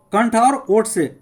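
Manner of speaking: ordinary talk, nothing marked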